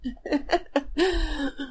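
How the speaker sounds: high breathy laugh at own joke; cracking up mid-impression; giggle. Real, not acted.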